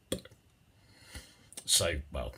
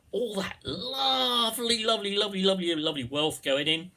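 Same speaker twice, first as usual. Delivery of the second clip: gushing; 8 semitones higher than usual. Pretend. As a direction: relish every lovely like a glutton. Gushing over lovely wealth.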